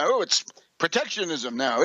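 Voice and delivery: ordinary talk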